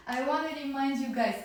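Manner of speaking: ordinary talk, nothing marked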